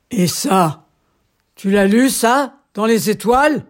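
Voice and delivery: loud, threatening royal outburst; angry shout. Acted. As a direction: bark it at the jester. Furious king threatens the jester.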